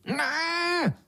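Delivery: very high, squealed refusal; long, piercing squawk; beetle's shriek. A narrator's reading. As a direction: screech it out in horror. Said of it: "zaječel černý brouk".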